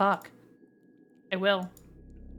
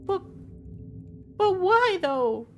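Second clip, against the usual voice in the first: whiny; 10 semitones higher than usual. Real, not acted. Whining over the lost romance.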